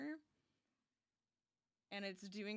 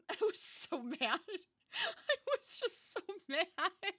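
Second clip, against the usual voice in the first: high, loud laughing through words; 10 semitones higher, 8 dB louder, slower than usual. Real, not acted. Laughing while telling viewers about the bite.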